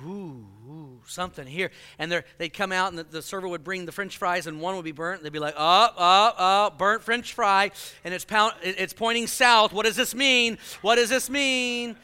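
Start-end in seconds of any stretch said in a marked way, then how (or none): 0.0-1.7 hushed, low, mock-spooky murmur; imitating an over-spiritual friend sensing a presence. Mimicking hyper friends sensing spirits. 5.6-7.7 loud, slow, hammed-up gasp of alarm; mock alarm. Mimicking friends alarmed at fries. 9.7-12.0 mock worry. Friends over-reading a sign.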